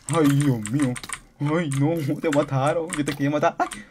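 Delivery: loud shocked wail of dismay; real shocked outburst; dismayed cry. Real, not acted. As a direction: cry out as your ally dies. Game death, loud dismay.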